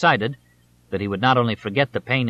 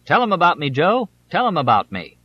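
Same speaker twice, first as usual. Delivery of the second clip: higher, louder call to the announcer; shouting across the crowd; 6 semitones higher, 4 dB louder than usual. A narrator's reading. Candidate calling to his announcer over the crowd, shouting. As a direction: holler it over everyone's heads. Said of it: "would shout to the announcer".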